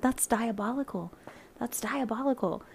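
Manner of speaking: voiced, hammed-up British accent on "That's diabolical", said twice with a falling end; bad British accent. Imitating the British guy's catchphrase, bad British accent. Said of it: "terrible British accent".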